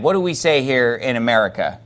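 normal talking voice

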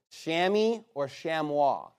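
"Chamois" first as SHAM-ee, then the French sham-WAH; two pronunciations. Shown as a demonstration. Teacher to class: "Chamois" as SHAM-ee, then sham-WAH. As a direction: say "Chamois" both ways, English then French. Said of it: "is what it's supposed to be pronounced".

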